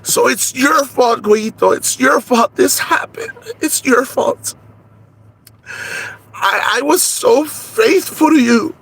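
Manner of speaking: high, slow wailing blame; tearful accusation. Acted. Conchita blaming Güeyito through tears, high and slow.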